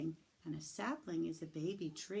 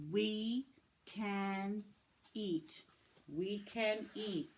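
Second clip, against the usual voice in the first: each word slow and separate; word by word reading; slower than usual. Shown as a demonstration. Teacher leading class reading, word by word. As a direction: read it out for kids to follow. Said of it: "You can read this with me".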